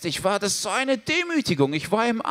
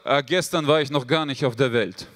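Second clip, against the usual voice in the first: deadpan father voice; 8 semitones lower than usual. Retold or imitated. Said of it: "Und mein Vater sagt".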